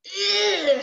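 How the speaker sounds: put-on high, groaned child's disgust. Sarah to her brother, high and disgusted. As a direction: draw out a high, revolted groan.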